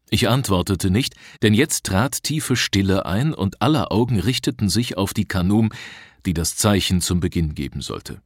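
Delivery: plain reading voice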